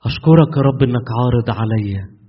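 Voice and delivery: earnest, loud prayer with long pauses; fervent prayer. Real, not acted. Preacher leading the congregation in prayer, fervent and loud. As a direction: pour out thanks to God, slow and fervent.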